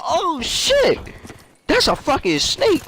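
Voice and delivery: a sudden high yelp of alarm; startled by snake. Retold or imitated.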